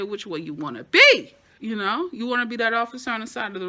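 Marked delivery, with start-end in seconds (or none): 0.0-1.3 louder low menacing quote; imitating Training Day cop. Crooked cop from Training Day confronting a rookie, mock menace. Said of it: "Stop the car ... and say".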